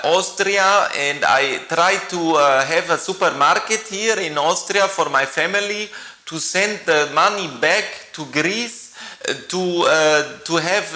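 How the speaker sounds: Greek accent. Shown as a demonstration. Greek shopkeeper introducing himself in a thick Greek accent. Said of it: "that's my Greek accent".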